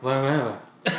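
soft, low gibberish echo; mimicking fast English. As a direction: mumble back the sounds you failed to catch. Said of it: "they literally couldn't, like, distinguish".

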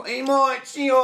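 lightly put-on high calling voice; imitating women calling to passers-by; mock street hostess. Guest mimics women outside karaoke bars calling him, mock high call. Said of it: "and they go".

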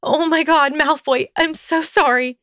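high, horrified Hermione voice; high, distressed apology; gasped apology. A narrator's reading. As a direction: pitch it right up, aghast and apologetic. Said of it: "she gasped".

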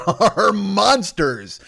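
slow words broken up by laughter; giggling while delivering the punchline; laughing. Acted. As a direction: laugh through it, stretching the words out. Joke about sausage inventors.

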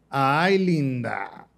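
high, loud, slow catcall; hammed-up shout of linda, drawn out; mock catcall shout. Soldiers in a passing van shouting at him, mock catcall. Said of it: "me grita".

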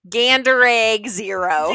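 loud, drawn-out punchline; emphatic joke. Real, not acted. Wife tops co-host's goose egg joke about her husband, emphatic. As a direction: hammer it home slowly and loudly.

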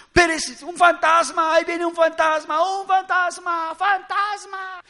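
high, loud cry of alarm, words stretched out; panicked disciples. Acted. Preacher acts disciples spotting Jesus on the water, panicked. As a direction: play up terror at seeing a ghost. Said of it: "Lo que pensaban los mortales, los discípulos".

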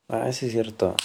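ordinary delivery

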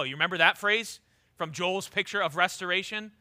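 usual speaking voice

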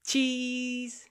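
high forced cheese; awkward forced cheese; forced photo smile. Shown as a demonstration. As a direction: fake a camera smile. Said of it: "I'm a smile".

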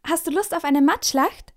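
put-on boy excitedly suggests mud fight; enthusiastic. A narrator's reading. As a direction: pitch the mud fight with glee. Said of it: "schlägt Anton begeistert vor".

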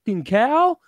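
high-pitched, indignant retold outburst; mock outrage. Man retelling his insult to a cop, indignant. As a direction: fling the insult high, drawing out the end.

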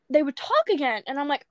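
usual speaking voice

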